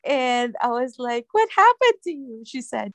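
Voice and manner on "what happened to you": high-pitched, acted-out surprise; retold astonishment. Nurse to patient back from hospital, acted astonishment. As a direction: ask it in exaggerated surprise, voice lifted.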